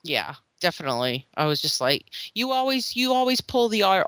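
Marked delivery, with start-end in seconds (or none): 2.3-4.0 higher-pitched imitation of a complainer; mimicked accusation. Mother imitates family member accusing her, higher exasperated voice. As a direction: mimic someone fed up with excuses.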